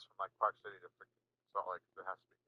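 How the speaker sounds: mouth full. Real, not acted. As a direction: still chewing while talking. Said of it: "Don't talk with your mouth full".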